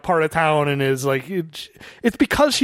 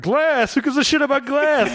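quoting Die Hard; 9 semitones higher than usual. Retold or imitated. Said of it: "you always quote this line from Die Hard".

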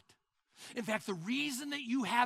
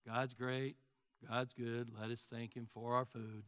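low, softer monotone mumble; mundane rote prayer; 9 semitones lower, 5 dB quieter than usual. Acted. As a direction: recite grace like a dull routine. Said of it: "It, it's mundane".

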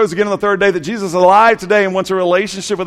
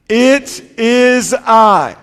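slow call-and-response; much slower than usual. Shown as a demonstration. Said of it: "Say that with me".

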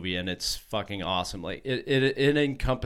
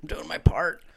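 a breathy joking whisper; 8 semitones higher than usual. Real, not acted. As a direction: whisper it like a confession.

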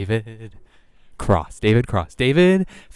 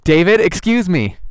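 calling out; 6 semitones higher, 4 dB louder than usual. Real, not acted. Calling out to stranger.

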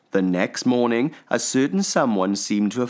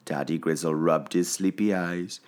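ordinary delivery

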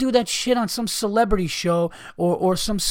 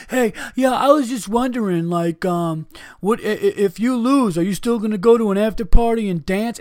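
mock nosy reporter, pitched higher with a laugh in it; 5 semitones higher, 4 dB louder than usual. Ranting about media day, he mimics a silly reporter. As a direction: lampoon a giggly reporter asking a silly question.

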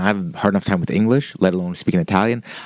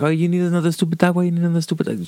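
higher-pitched mock nagging; insulting grandma; 8 semitones higher, much faster than usual. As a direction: imitate a grandma griping in a raised pitch. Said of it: "she starts insulting my puppy".

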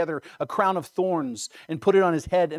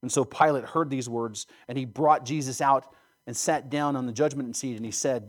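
ordinary talk

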